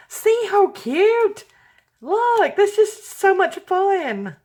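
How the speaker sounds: high, slow, drawn-out cooing; cutesy gush. Real, not acted. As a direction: show it off like a treasure. Showing crafts to viewers.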